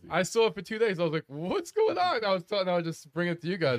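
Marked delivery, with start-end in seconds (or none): 1.3-2.2 surprised. Retold or imitated. Retold confusion at headline.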